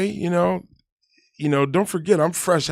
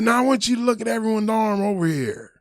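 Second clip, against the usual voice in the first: much higher and louder commanding sergeant; drill sergeant lecture; 10 semitones higher, 6 dB louder than usual. Retold or imitated. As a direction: order the recruit to look, rising in force. Sergeant lectures private loudly.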